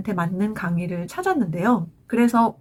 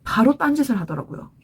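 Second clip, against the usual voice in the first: usual speaking voice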